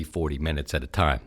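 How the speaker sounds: ordinary talk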